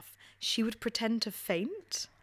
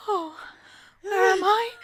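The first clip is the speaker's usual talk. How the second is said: dazed fainter; 11 semitones higher, 7 dB louder than usual. Retold or imitated. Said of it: "she'd be like".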